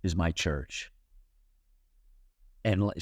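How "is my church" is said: inner voice. Retold or imitated. A voice inside Helen tells her, low and hushed. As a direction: let it sound like a voice from within, hushed and low. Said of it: "almost like a voice in her say".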